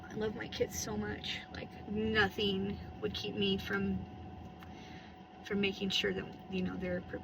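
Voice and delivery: soft, tender whisper. Real, not acted. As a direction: hush it, heartfelt and low. Tender confession about her kids.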